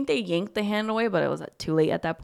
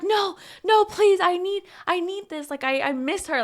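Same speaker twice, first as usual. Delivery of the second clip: high, urgent begging; desperate pleading; 10 semitones higher, 4 dB louder than usual. Retold or imitated. Mia begs her friend for more time, desperate pleading. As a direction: beg loudly at first, then ease off.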